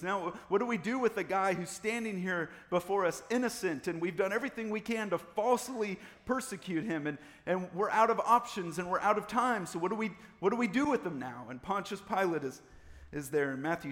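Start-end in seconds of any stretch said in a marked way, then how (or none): 0.0-11.5 loud, raised voice, at a loss; exasperated accusers. Acted. Accusers debating what to do with Jesus, exasperated and loud. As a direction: argue urgently as if cornered.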